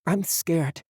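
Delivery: put-on high, frightened boy voice; small scared child. A narrator's reading. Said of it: "he said, in the same strange accent".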